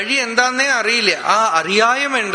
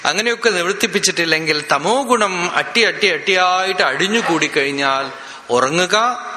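ordinary delivery

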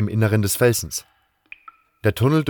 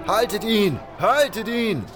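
high-pitched cry to pursuers; alarm call; 12 semitones higher, slower than usual. Acted. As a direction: raise the alarm, voice pitched right up. Guards shout after the fugitive.

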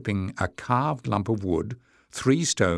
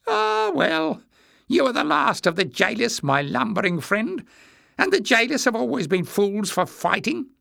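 high-pitched, condescending little man; haughty; 13 semitones higher than usual. A narrator's reading. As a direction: look down on them in a high voice. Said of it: "looking down his nose".

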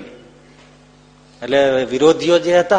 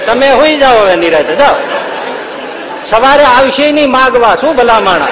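loud triumphant wife; 7 semitones higher, 6 dB louder than usual. Retold or imitated. Wife tells husband to sleep.

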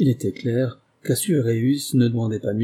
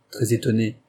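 plain reading voice

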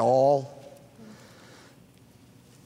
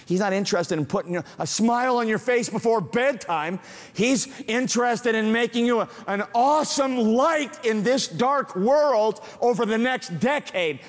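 rising preaching; 9 semitones higher than usual. Acted. Builds in sermon.

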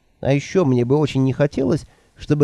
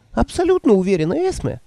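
put-on higher, timid old woman voice; meek old woman; 9 semitones higher than usual. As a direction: answer meekly, eager to please. Nanny voiced by narrator.

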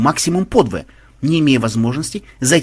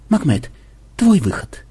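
hushed, half-whispered cue; rustling, whispery voice; rustling murmur; 2 semitones higher than usual. A narrator's reading. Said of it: "прошелестел Ксенобайт".

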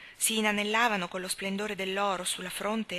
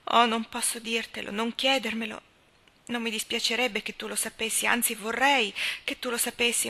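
higher-pitched, distressed protest; anguished reluctance; 4 semitones higher, 3 dB louder than usual. Acted. Leslie cannot tell Anna her secret, distressed and ashamed. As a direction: struggle to confess and fail. Confessing shame to a friend.

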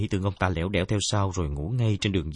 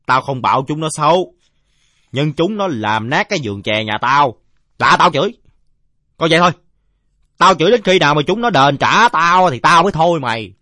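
loud, raised-voice grievance; aggrieved drunkard; 8 semitones higher, 12 dB louder than usual. Acted. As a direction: rail at the kid like a wronged drinker. (giọng ông say lớn tiếng kể tội)

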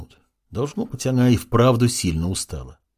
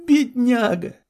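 laughing; slower than usual. A narrator's reading. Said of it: "засмеялась во весь голос".

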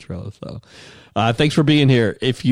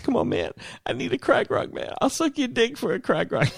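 very high, breathy desperate plea; crackhead voice; 13 semitones higher, 3 dB quieter than usual. Retold or imitated. Crackhead begging for a crack rock. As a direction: beg in a squeaky strained whine. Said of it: "He's like".

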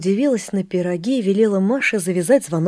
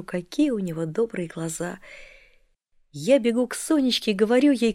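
ordinary delivery

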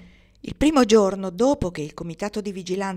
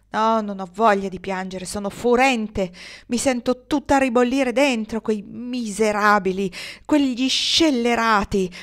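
louder, slower, rising fury; furious outburst; 4 semitones higher, 7 dB louder, slower than usual. A narrator's reading. Kendra furious at the outlaws after Hiram leaves. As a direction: spit out the anger word by word. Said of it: "rispose con forza inaspettata".

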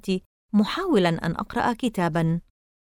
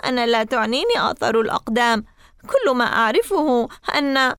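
crying; 4 semitones higher, 3 dB louder than usual. A narrator's reading. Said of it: "قلت باكيا".

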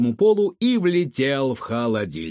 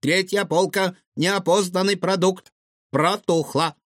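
higher-pitched robotic appliance announcement; electronic voice; 6 semitones higher than usual. A narrator's reading. Fridge reporting spoiled food, electronic voice. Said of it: "электронным голосом констатировал холодильник".

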